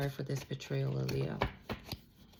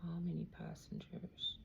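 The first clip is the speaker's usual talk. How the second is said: hushed whispered question; 10 dB quieter than usual. Real, not acted. Whispering to herself while reading cards. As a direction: breathe the question under your breath.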